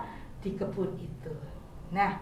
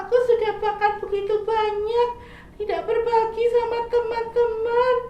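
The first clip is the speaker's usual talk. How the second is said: much higher, louder, whiny little caterpillar; sick caterpillar; 11 semitones higher, 6 dB louder than usual. Acted. Caterpillar telling squirrel his stomach hurts, high whiny voice. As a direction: answer like a sorry sick child.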